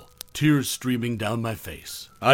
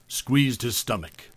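ordinary delivery, nothing marked

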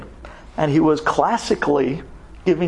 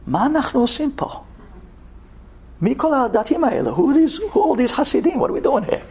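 bewildered son; 9 semitones higher than usual. Retold or imitated. Healed son wakes up confused.

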